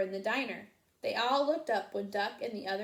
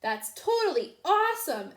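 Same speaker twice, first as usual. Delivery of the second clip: put-on excited boy; 7 semitones higher, 6 dB louder than usual. A narrator's reading. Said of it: "Edison shouted".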